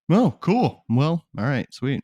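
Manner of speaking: casual coworker. Retold or imitated. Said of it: "What are they gonna go?".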